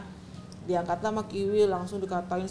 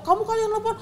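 much higher, sharp accusation; accusing; 12 semitones higher, 3 dB louder, faster than usual. Retold or imitated. Husband accusing his wife of making the call. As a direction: throw the blame at her.

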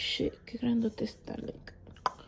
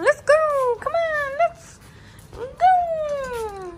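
very high, loud excited calling; squealy urging; 10 dB louder, much slower than usual. Real, not acted. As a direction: hype the dog up to come.

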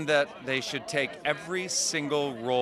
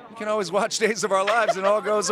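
louder, higher enthusiastic pitch; gushing red carpet chatter; 5 semitones higher, 5 dB louder than usual. Real, not acted. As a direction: sell the escape with a big lift. Climax of the message to moms.

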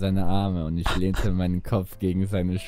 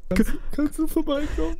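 much higher, stammered plea; high pleading. Acted. Felix begging his friend on the phone, high and pleading. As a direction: beg in a squeaky voice.

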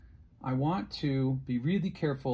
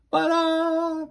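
very high, loud squeak; squeaky mock hesitation; 11 dB louder than usual. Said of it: "great intro, right?".

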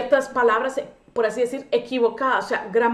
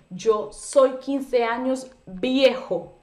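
slow literal version; much slower than usual. Shown as a demonstration. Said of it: "utilizo el verbo ser".

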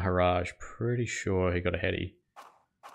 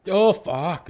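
high, loud startled swear; shocked curse; 10 semitones higher, 6 dB louder than usual. Real, not acted. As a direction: blurt it out at the near miss. Inside edge near miss.

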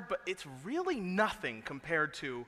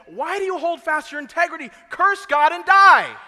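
loud, higher, bitter cry that swells to a shout; bitter wife's outburst; 11 semitones higher, 18 dB louder than usual. Retold or imitated. Job's wife lashing out at her sick husband, shouting bitterly. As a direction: fling the words out in bitter rage.

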